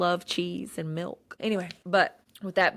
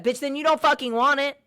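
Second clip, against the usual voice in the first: harsh scolding; 9 semitones higher, 5 dB louder than usual. Real, not acted. Scolding lazy listeners.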